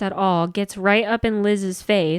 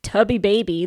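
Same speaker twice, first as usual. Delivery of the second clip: usual speaking voice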